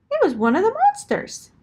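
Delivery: spring the surprise on the listener; excited gasp of a reveal; surprised reveal. A narrator's reading.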